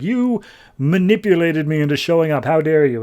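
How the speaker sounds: a hammed-up how dare you; outraged. Frankenstein outraged at manipulation.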